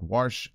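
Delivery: "wash" pronounced "warsh"; mispronounced with an r. Shown as a demonstration. Speaker owning up to his habit, "wash" as "warsh". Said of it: "I usually say the word".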